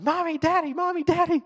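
put-on high, loud little boy crying for his parents; child calling out. A little boy wakes his parents at night, calling high and loud. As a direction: call out like a small child woken in the night.

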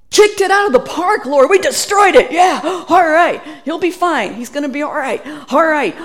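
loud, high victory whoop; triumphant cheer. Retold or imitated. Preacher recalls cheering to the Lord after the crusade, loud and triumphant. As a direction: crow over a win, shouting it out. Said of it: "And I thought".

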